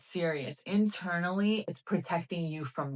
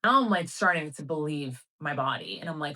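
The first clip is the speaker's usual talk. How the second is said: ordinary delivery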